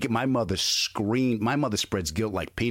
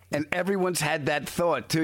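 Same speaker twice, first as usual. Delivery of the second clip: ordinary talk, nothing marked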